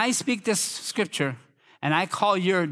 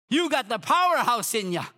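high, driving exhortation; preacher pressing the congregation; fervent preaching; 9 semitones higher than usual. Real, not acted. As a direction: preach at them in insistent bursts.

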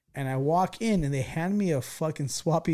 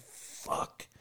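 whispered under the breath; a busted kid whispering to himself; whispered curse; 13 dB quieter than usual. Retold or imitated. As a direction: whisper it, dreading being caught. Caught, cursing to himself.

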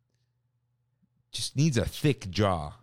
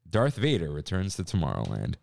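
usual speaking voice

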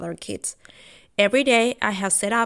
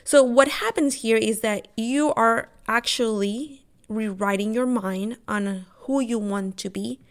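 usual speaking voice

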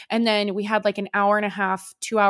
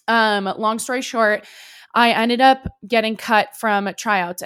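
normal talking voice

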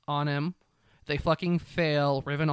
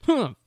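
mock huff; 8 semitones higher, 5 dB louder than usual.

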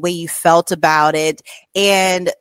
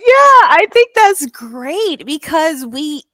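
eager; 10 semitones higher, 6 dB louder than usual. Real, not acted. Host bursts in eagerly.

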